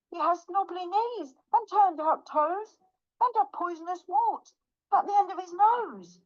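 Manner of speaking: high, anxious little mouse voice; high-voiced mounting alarm. Mouse meets the real monster.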